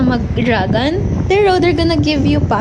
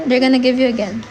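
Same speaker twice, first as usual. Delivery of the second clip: ordinary talk, nothing marked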